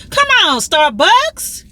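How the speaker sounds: high, drawn-out cheer. Real, not acted. Praising Starbucks for its outdoor seating, high excited cheer. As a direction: hype up the store.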